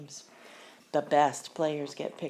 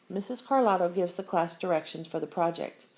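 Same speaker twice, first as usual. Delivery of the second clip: usual speaking voice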